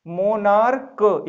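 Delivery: "मोनार्क" slowly, with a separate final k; drawn-out k pronunciation. Shown as a demonstration. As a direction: stretch "मोनार्क", then release the k. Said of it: "इसको भी का पढ़ते हैं". ("मोनार्क" खींचकर, अंत में क)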